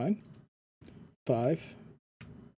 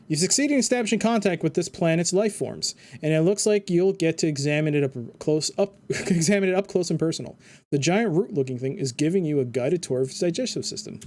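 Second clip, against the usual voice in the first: reading game text like a narrator; game narration; 3 semitones higher than usual. Acted. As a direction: narrate the scene with dry relish. Reads game narration aloud.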